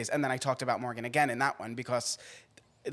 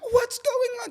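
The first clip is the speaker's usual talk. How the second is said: very high, tearful panic; imitating a sobbing, bewildered friend; confused crying; 5 dB louder, slower than usual. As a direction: wail it half asleep and confused. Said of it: "she's like".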